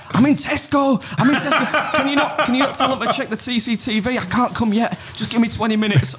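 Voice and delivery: high, frantic mum on the phone; panicking mum. Retold or imitated. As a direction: play the flustered mum in a supermarket rush.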